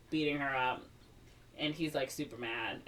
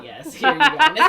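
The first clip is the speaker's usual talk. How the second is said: bubbly, laughing handover; 10 semitones higher, 15 dB louder than usual. Acted. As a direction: giggle through it, high and loud. Girl happily hands over gift.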